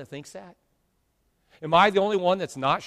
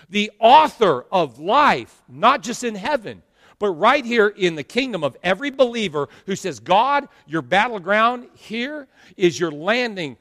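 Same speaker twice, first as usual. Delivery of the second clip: fervent pulpit prayer; preaching; 5 dB louder than usual. Acted. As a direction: proclaim it like a battle prayer.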